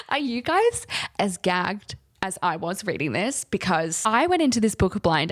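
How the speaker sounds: usual speaking voice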